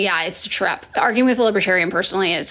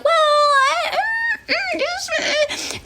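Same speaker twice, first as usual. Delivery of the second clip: much higher, whimpering mock stammer; flustered stammer; much slower than usual. Mocking a defensive liberal, high whimpering stammer. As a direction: fake a cornered, teary fumble for words.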